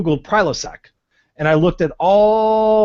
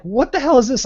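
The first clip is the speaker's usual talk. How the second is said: higher, disbelieving what the hell; incredulous outburst; 9 semitones higher than usual. Retold or imitated. Patient outraged at doctor over heartburn pills, incredulous. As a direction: snap back in disbelief at the prescription. Reacting to Prilosec prescription.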